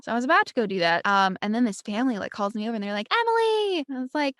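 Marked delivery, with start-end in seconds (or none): 3.1-3.9 high, bright call of a name, much higher; kids calling out. Retold or imitated. Family calling Emily over from the line, high eager call.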